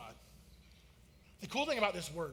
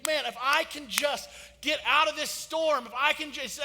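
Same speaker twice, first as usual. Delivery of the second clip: desperate plea; 5 semitones higher, 5 dB louder than usual. Retold or imitated.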